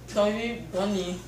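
mumbled through a mouthful of marshmallows; cheeks stuffed full. Real, not acted. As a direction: talk with your cheeks packed full.

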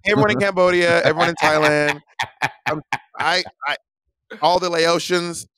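mock the co-host with a loud, high greeting to Southeast Asia; teasing the co-host by hollering at Cambodia, in a high, loud mock voice; Monet impression. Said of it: "that was Monet Exchange's voice".

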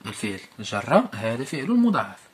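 usual speaking voice

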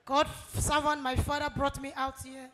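normal talking voice

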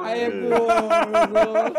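loud, very drawn-out gushing; playful, exaggerated long vowels; imitating fawning over someone cute. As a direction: stretch it out as if cooing at a cutie. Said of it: "quando é uns gordinhos fofinhos".

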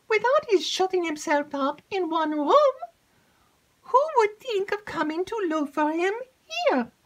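high, loud eager maid's voice; breathless excited maid. Acted. Maid rushes in to tell Emily news, excited.

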